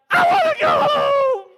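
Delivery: put-on loud, very high wailing child voice; whiny child. Boy at the top of the wall, high wailing plea. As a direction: wail it like a scared little boy. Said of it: "and he's like".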